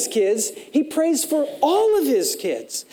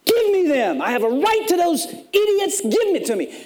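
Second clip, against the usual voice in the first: Satan demanding; 6 semitones higher than usual. Retold or imitated. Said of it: "He's the accuser".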